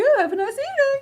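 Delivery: high, cheerful policeman voice; chirpy, amused officer; high friendly policeman. Acted. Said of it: "and he's like".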